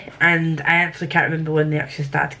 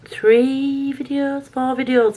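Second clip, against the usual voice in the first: excited; 8 semitones higher, slower than usual. Real, not acted. Proud of filming count.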